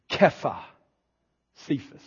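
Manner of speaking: ordinary talk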